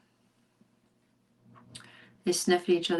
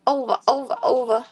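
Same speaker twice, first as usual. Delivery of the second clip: eager urging; 4 semitones higher, 7 dB louder than usual. Real, not acted. Urging a flip.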